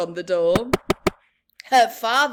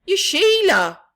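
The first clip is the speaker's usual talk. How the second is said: shocked recognition; 7 semitones higher, 10 dB louder than usual. Acted. Father recognizes daughter.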